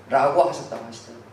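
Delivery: usual speaking voice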